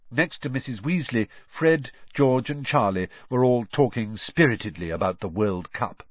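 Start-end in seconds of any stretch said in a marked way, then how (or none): none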